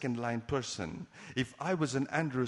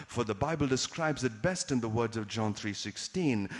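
normal talking voice

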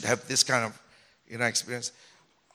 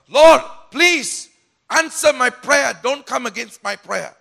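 louder, higher, heated complaint to God; raised angry tone; 6 semitones higher, 8 dB louder than usual. Retold or imitated. Preacher retells arguing loudly with the Lord in prayer, heated and loud. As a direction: press the grievance hard and loud. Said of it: "You raise your tone. And your words are a bit strong".

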